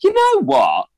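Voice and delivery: high-pitched opening, easing down into resolve; acted defiant resolve. Younger self deciding to embrace weirdness, defiant resolve. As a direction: announce a sudden rebellious decision. Acts out his defiant decision.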